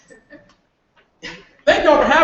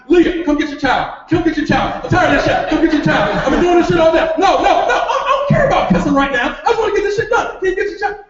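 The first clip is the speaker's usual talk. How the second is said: raised, high-pitched fed-up outburst; exasperated yelling; 9 semitones higher, 4 dB louder, faster than usual. Acted. Overwhelmed father calling Leah to take the child, exasperated shouting. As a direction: build from calling out to a high, frantic rant.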